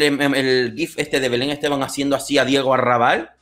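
normal talking voice

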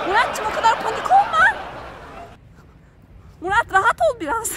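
laughing teasing. Real, not acted. Teasing panicked husband.

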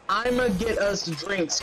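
usual speaking voice

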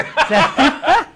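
loud high burst of laughter; big high laugh at his own story. Real, not acted. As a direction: let out a big delighted laugh.

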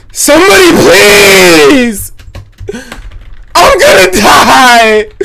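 high, slow mock wail for help; panicking gamer. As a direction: plead like you're about to die. Low HP, begging for help.

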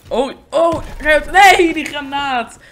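loud, high yelps into a drawn-out no; real gamer outburst, stretched at the end; panicked shout. Real, not acted. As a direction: yell in alarm at incoming danger. Grenade lands on him.

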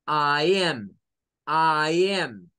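I am with am between a and e; American I am; short a I am. Shown as a demonstration. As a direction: say am with a short a mixing a and e. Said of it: "suena más la E".

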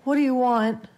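alarmed mother calling out; shaky call. A narrator's reading. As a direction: call out in fear at an unseen voice. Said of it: "called out shakily".

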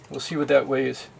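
ordinary delivery

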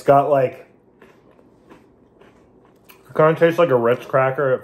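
speaking between bites; talking while chewing. Real, not acted. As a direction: mouth partly full of cracker. Seen 0: Man holds a cracker piece, bites it near +5.4, then talks while chewing.

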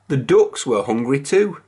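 usual speaking voice

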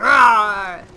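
loud mock roar of big brutes; sudden, drawn-out pretend roar; imitated roar. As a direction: roar like a charging heavy gunner. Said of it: "They're just like".

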